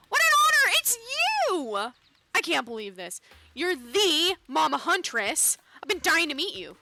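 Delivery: loud, high starstruck outburst, easing slightly; gushing excitement. Acted. Meeting the Mama Huntress, gushing excitement. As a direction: gush like a thrilled fan.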